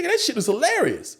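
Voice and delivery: high-pitched retold enthusiasm; insistent amusement. Friend praises his bad-day story, high and insistent.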